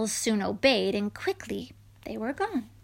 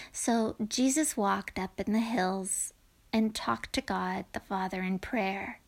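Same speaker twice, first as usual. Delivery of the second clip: plain reading voice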